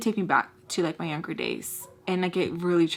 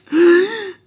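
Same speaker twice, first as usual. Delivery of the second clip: huge, loud theatrical gasp; imitated shocked gasp, soap-opera style; telenovela gasp; 11 semitones higher, 13 dB louder than usual. Imitating the man seeing her face, melodramatic gasp. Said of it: "like a telenovela".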